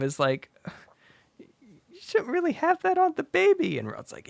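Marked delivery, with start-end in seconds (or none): 1.4-3.8 high-pitched motherly scolding; mock fretting mom. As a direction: chide gently as a concerned mom. Mom chides Rod about baby.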